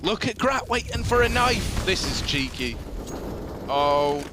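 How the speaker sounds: Aussie play-by-play, hyping then hushing; mock Australian commentary; Australian caster accent. Casting Grat's play in his Australian accent. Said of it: "grasp of the Australian accent".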